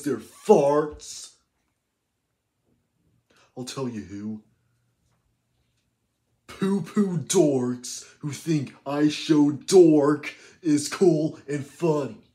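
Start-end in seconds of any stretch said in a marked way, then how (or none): none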